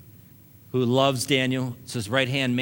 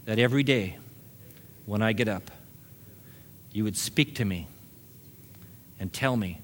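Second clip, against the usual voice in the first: led prayer; 3 semitones lower, 4 dB quieter than usual. Acted. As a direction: pray it softly for them to repeat. Leads a prayer.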